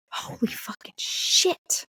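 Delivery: slow, voiceless disbelief; hushed, retold astonishment; stunned whisper. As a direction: whisper it slowly, drawing out each word.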